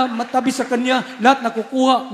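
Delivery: ordinary talk, nothing marked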